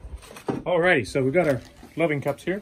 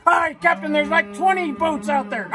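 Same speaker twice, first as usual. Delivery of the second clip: much higher, louder, slow hammed-up pirate sailor; panicked pirate lookout; 12 semitones higher, 6 dB louder, slower than usual. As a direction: squeal the alarm like a terrified cartoon pirate. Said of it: "And he goes".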